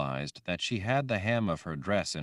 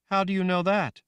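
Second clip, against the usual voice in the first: put-on high, loud young girl voice; childlike Rachel; 12 semitones higher, 6 dB louder than usual. Girl Rachel asking the woman, high and loud. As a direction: sound like a scared little girl.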